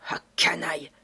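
a whispered swear word; muttered curse. Acted. Sholmès curses Lupin under his breath, whispered. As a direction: hiss it under your breath. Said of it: "Il se baissa en jurant".

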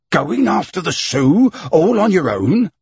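rising, disbelieving protest; incredulous. A narrator's reading. Fix doubting Fogg's plan, incredulous. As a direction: press him in disbelief. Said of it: "cried out Fix".

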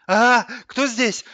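high, loud frightened cry; startled awake. A narrator's reading. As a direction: jolt out of sleep in alarm. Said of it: "спросонок испугался диван". (испуганно спросонок, громко)

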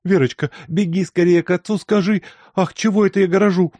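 flustered mother. A narrator's reading. Flustered mother sends daughter off.

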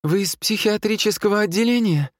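very high-pitched female voice; nurse's voice. A narrator's reading. Nurse questioning the armed men, high female voice. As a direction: ask it high, as a woman. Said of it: "спросила медсестра".

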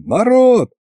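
high calling voice to a crowd; shouting to the crowd. A narrator's reading. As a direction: project over the square. Addressing the crowd loudly.

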